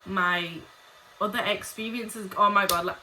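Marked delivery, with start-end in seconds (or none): none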